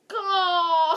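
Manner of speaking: very loud, high, wobbling drawn-out wail; dramatic wail. A narrator's reading. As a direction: stretch it into a hammed-up howl. Dramatic climax of the chapter.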